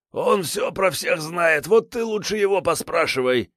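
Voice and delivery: high whiny frightened thug; a cornered petty crook pleading; scared thug voice. A narrator's reading.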